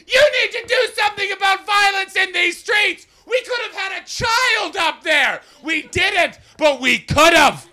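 high, loud hysterical yelling; hysterical shouting. Retold or imitated. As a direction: scream at the cops in a frenzy. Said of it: "she goes hysterical to the nines".

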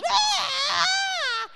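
high, loud imitated shriek with a laugh; mock scream. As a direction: shriek again as the possessed woman. Possessed woman screaming again.

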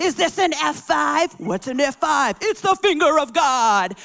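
loud, slow dramatic film line; hammed-up quote with heavy weight; movie quote. Wife quoting a storm movie at her husband, dramatic. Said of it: "quoting Twister".

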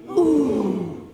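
loud group ooh, impressed; a chorus of impressed ooh; fireworks noise. Shown as a demonstration. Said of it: "that firework noise".

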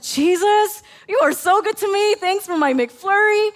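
overflowing delight at a small gift; joyful thanks. Retold or imitated. Grateful outburst after the McFlurry gift.

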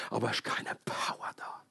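gehauchte Pointe im Flüsterton; geflüstert. Real, not acted. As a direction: die Pointe ganz leise hinflüstern. Pointe über kraftlose Gemeinde. (Prediger flüstert der Gemeinde die Pointe zu)

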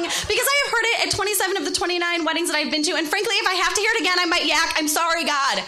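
mock exasperation, racing along; exasperated rant. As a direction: rattle off the complaint, fed up. Acted rant against a wedding reading.

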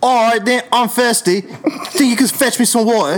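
loud, high-pitched Cockney; Cockney accent. Shown as a demonstration. Friend performs a requested line in a loud Cockney accent. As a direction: belt it out in broad Cockney. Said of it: "The Cockney".

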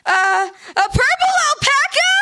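put-on breathless, stammering little kid guessing wildly; small child gasping out a wild guess to dad; excited kid. Kid guesses what hit bus.

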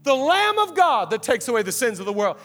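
high, loud awestruck proclamation, easing off; tripping out. Retold or imitated. John seeing Jesus first time, imitated in high excited cries. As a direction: cry it out as if losing your mind. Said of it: "and he trips out".

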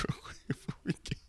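soft whispered aside; whispering. Real, not acted. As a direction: whisper it quickly. Aside before playing a track.

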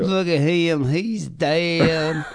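light mock Southern accent. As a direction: lightly put on a Southern drawl. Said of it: "that Southern accent".

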